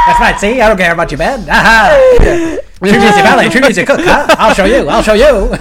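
loud, cackling mock defiance. Fired valet trashing the bed, loud laughing defiance. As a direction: shout it gleefully between laughs. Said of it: "And he just like".

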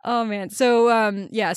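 usual speaking voice

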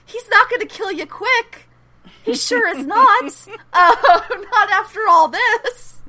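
high, loud, laughing warning; laughing mock dread. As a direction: crow it through laughter. Gleefully voicing a viewer's dread.

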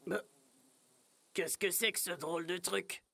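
low, soft puzzled wolf; dim wolf voice. Acted. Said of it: "se demande-t-il".

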